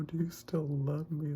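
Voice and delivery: high, slow weepy wail; drawn-out, high-pitched mock crying; tearful. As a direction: wail it as if moved to tears. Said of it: "I started crying".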